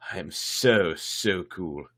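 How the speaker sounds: plain reading voice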